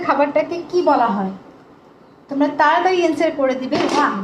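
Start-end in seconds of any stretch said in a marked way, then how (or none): none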